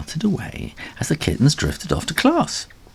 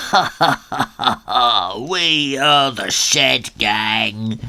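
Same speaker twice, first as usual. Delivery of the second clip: slow triumphant villain cackle; gloating gang laugh; 4 semitones higher, slower than usual. Acted. Shed Gang gloats over Ginger, slow cackle.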